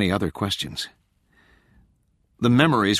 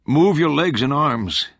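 higher, slow, firm command; insistent; 6 semitones higher, 4 dB louder than usual. A narrator's reading. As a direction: press the order slowly and firmly. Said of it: "the man insisted".

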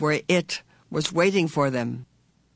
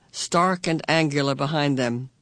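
usual speaking voice

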